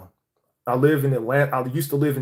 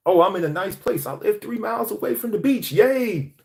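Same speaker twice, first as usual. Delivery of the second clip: higher, lightly mocking his old self; mock-cheerful, a touch naive; lightly sarcastic cheer; 6 semitones higher than usual. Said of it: "I thought simply that moving was the answer".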